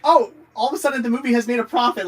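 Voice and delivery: loud, high fake astonishment; mock surprise. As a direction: feign sudden discovery. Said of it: "they're like".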